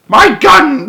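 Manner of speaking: high, loud outraged yell; furious shouting. A narrator's reading. Duke shouting to gardener for gun, furious yell. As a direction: yell for it in fury. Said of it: "the Duke was shouting".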